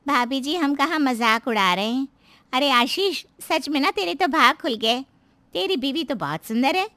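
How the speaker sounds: high, loud neighbour woman gushing; high-pitched, insistent flattery; placating neighbour. Acted. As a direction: deny the teasing, then praise emphatically.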